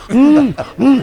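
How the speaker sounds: high muffled hum with mouth full; muffled mock moan. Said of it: "She's like".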